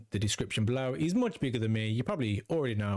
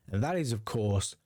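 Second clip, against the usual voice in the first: usual speaking voice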